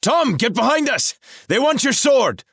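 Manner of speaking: high, loud battle yell; yelling warning. A narrator's reading. Woodsmoke yells to Tom in battle, loud. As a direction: shout the warning across the fight.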